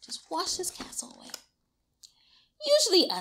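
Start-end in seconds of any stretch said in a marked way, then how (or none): 0.1-1.3 whispered aside; fast whispered aside. Real, not acted. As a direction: hush it to the listener. Aside to child.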